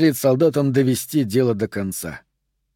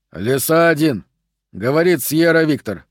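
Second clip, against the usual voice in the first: usual speaking voice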